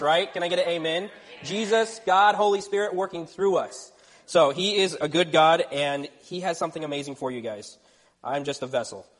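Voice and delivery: ordinary delivery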